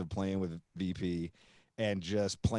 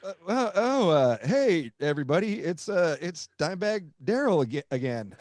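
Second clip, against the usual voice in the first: higher, louder hype-man call to the crowd; stage announcer; 5 semitones higher, 4 dB louder than usual. Retold or imitated. [name] announcing [name] to the crowd, hyped announcer voice. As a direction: announce him like a surprised frontman. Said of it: "and [name] is like".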